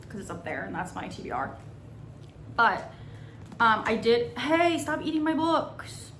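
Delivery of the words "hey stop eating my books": slow high scolding of a pet; scolding the dog. Real, not acted. Scolding dog chewing books.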